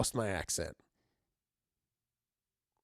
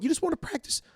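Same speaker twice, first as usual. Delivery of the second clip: squeaky high encouragement; 13 semitones higher than usual. Acted. Bunker host urging viewers to practise, squeaky high rise. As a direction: jump way up high, rising at the end.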